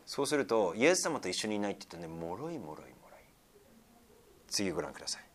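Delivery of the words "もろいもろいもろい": soft whisper, much softer; whispered lament. Acted. Preacher lamenting human weakness in a whisper. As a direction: breathe it out sadly.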